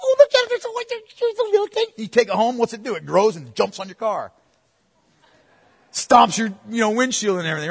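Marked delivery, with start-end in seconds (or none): 0.0-1.8 put-on high gushing baby-animal coo; high-pitched cutesy cooing; cooing over baby animals. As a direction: gush like someone melting over a cute pet. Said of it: "When you see 'em and they're little".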